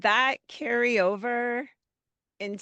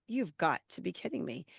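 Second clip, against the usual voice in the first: muttered disbelief; 2 semitones lower, 8 dB quieter than usual. Retold or imitated. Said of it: "I mentally hit the ceiling".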